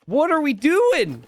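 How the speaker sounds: slow, high, loud "What are we doing?" with "doing" stretched and falling; drawn-out disbelief. Real, not acted. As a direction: drag out each word in mock despair. Streamer repeating call-out, drawn out.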